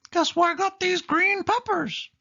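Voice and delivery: very high squeaky mouse voice; teasing high cartoon squeak; Mickey voice. A narrator's reading. Mickey quizzes Minnie about peppers, high squeaky voice. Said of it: "said Mickey".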